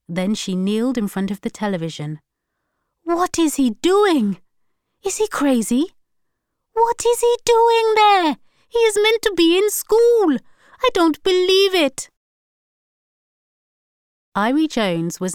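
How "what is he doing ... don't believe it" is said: very high, loud frantic disbelief; horrified mother. A narrator's reading. Alsana kneeling at the TV, frantic and disbelieving. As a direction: panic rising at the screen. Mother sees son on news.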